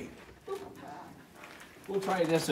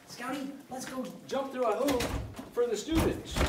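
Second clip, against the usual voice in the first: calling a dog; 6 semitones higher than usual. Real, not acted. Teacher coaxing his dog.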